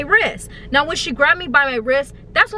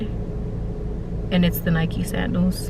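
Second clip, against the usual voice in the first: ordinary talk, nothing marked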